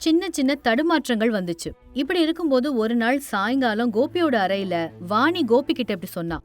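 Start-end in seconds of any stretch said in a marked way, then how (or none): none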